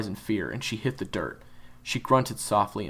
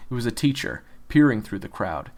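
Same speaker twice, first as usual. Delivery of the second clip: plain reading voice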